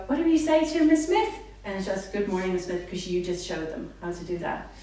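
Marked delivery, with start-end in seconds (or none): 0.1-1.3 high, loud coaxing prompt; prompting child. Shown as a demonstration. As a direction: cue the child to answer, sing-song. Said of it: "And then you go".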